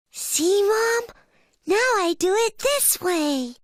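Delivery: put-on very high young bear voice; little bear cub. A narrator's reading. Little bear shows Mom his grown-up way, high cub voice.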